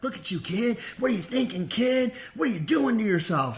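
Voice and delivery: lightly voiced cop lecturing a kid; mock cop. Cops scolding him on the curb, lightly voiced. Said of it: "they're like".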